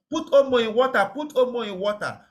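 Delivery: high, quick call to put Omo in water; mock urgent cry at a fire; retold shout. People at a fire shouting for Omo, mock urgency. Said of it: "people shouting".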